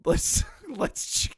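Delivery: high, breathless stammer through laughter; laughing. Real, not acted. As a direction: choke on giggles while reading. Laughing while trying to read.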